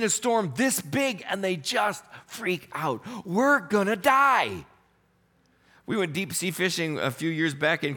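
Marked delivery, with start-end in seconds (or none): 3.2-4.7 panicked cry. Acted. Disciples panicking in the storm.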